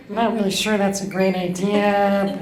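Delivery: ordinary talk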